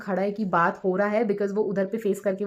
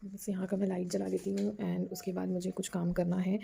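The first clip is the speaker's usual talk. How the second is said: talking softly; 6 dB quieter than usual. Real, not acted. Mother talks softly while her baby son sleeps, hushed voice. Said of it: "मैं बहुत धीरे धीरे बोल रही हूँ".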